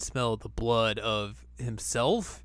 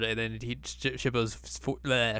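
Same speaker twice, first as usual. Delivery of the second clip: ordinary talk, nothing marked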